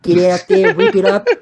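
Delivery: high, loud mock Australian; rally them in an Australian accent; Australian steelworker. Steel mill worker at the pub, rousing Australian accent. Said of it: "it's like a Australian guy".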